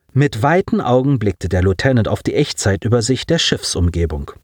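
usual speaking voice

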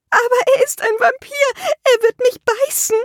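begging the dwarf to save her; terrified plea. A narrator's reading. Therapist pleads again.

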